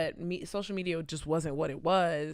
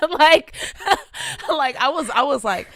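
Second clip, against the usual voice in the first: high, loud laughter through words; laughing; 10 semitones higher, 15 dB louder than usual. Real, not acted. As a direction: laugh while talking. Bursting into laughter at joke.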